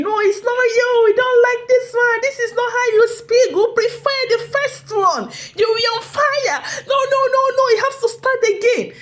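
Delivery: high, loud mock shouting; imitating screaming colleagues. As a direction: yell it like a crowd of colleagues protesting. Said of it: "they were screaming".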